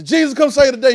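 high, loud mock holler. Somebody in church hollering for Jesus, loud. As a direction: holler it up to heaven. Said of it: "I heard somebody say".

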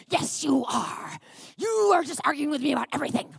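put-on argumentative outburst, speeding up; hammed-up indignant accusation; mock flare-up. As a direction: imitate a husband's accusing retort. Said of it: "flare up at me again".

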